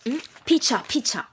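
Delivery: usual speaking voice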